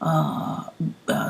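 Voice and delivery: normal talking voice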